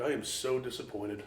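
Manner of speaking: softly imitating a let-down friend; quiet disappointment. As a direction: say it soft and let down, like a quiet scolding. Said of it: "He, like, got quiet for a second".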